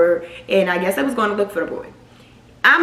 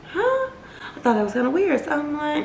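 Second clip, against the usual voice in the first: normal talking voice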